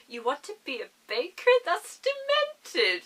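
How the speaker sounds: put-on manipulative mother, incredulous and soaring; Mother Gothel. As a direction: draw out the scorn in a high put-on voice. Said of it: "hear her voice".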